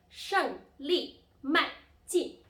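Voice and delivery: 胜利迈进 loud and high, each syllable falling sharply; emphatic falling-tone drill on 胜利迈进; fourth-tone demo. Shown as a demonstration. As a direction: punch out 胜利迈进 slowly, one falling syllable at a time. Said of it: "symbol of the falling tone".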